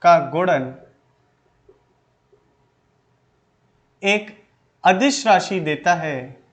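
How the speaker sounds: measured dictation pace with long pauses between phrases. Acted. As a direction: spell it out phrase by phrase so students can write. Dictates notes to students slowly.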